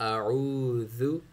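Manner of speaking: slow अऊज़ु ending on a soft ज़ु; soft zaal. Shown as a demonstration. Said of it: "ज़ाल को soft".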